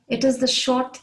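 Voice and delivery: ordinary talk, nothing marked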